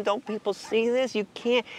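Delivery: usual speaking voice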